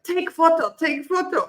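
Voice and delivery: high, blunt broken-English request; broken English tourist. Retold or imitated. Retelling the Russian tourist at the walking tour, imitating his broken English. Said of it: "he's like, a broken English".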